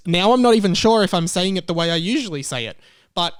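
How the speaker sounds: ordinary delivery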